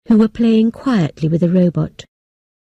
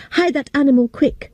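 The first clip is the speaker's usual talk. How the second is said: shouting; 5 semitones higher than usual. Acted. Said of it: "I shouted to the robot".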